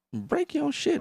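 high goofy alien voice; mock Jar Jar. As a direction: squeak the threat like Jar Jar. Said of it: "He's like".

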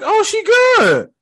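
high, drawn-out reaction; high-pitched relieved quote. Retold or imitated. Movie fan replaying his relief at the aunt getting up, high-pitched. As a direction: let it float up high and long.

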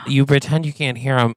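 ordinary delivery

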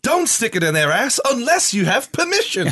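high cartoon conscience voice; Jiminy Cricket. Host imagines a children's version, cartoon conscience voice. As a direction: squeak out advice like a cartoon. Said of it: "wish they'd bring out a children's version".

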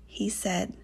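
ordinary delivery

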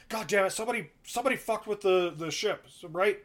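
lightly voiced captain grumbling; angry captain. Suspicious captain cursing.

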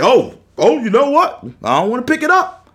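high, loud pretend excuse; mock casual refusal. Imagined person dodging life's final phone call, mock refusal. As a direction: play someone trying to dodge the call.

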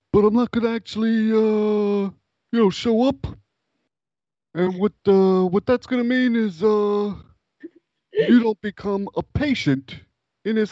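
high, Italian-American wiseguy voice with stretched filler words; Italian mobster. Acted. Italian guy at hospital job interview, menacing wiseguy voice. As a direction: make a veiled threat as an Italian wiseguy. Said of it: "his job interview went something like this".

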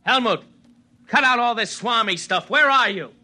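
impatient shout into an empty hall; calling out. Acted. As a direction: call out impatiently to someone unseen. Calling for a hidden Helmut.

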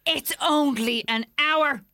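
high, slow begging plea; pleading complaint. Retold or imitated. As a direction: read it as a pleading listener. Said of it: "And it goes like this".

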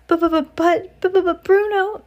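very high, loud panicky stutter; flustered father in squeaky alarm; stammered. A narrator's reading. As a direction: splutter and stick on the first sounds. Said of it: "stammered Mister Jenkins".

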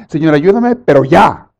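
loud, high, impatient demanding; mock desperate believer insisting on right now; desperate pleading prayer. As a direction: whine at God to hurry up. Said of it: "hay gente desesperada que le dice a Dios".

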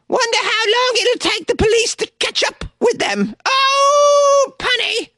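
very high, squealed mock delivery; high-pitched voice drawing out the pun; high put-on voice. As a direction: read the joke in a silly falsetto. Voicing a resident's jokey written comment.

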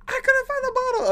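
put-on very high, whiny child; whiny kid voice. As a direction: squeak it like a kid. Voicing the kid.

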